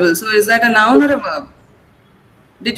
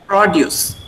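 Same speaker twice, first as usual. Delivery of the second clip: produce as PRO-duce; first-syllable stress; 4 semitones lower than usual. Shown as a demonstration. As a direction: make PRO prominent in produce. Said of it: "stress is on the first syllable".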